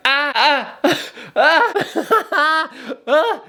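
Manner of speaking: short gasping moans, half laughing; moaning. Retold or imitated. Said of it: "I just need to be like".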